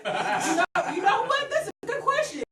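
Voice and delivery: laughing. Real, not acted. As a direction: keep laughing as you answer. Laughs answering the question.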